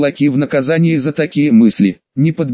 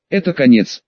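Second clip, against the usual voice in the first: plain reading voice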